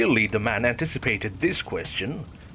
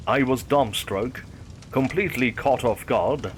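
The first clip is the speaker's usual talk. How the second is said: usual speaking voice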